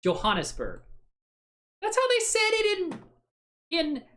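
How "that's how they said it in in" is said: mock squeaky voice. Defending pronunciation, mock voice.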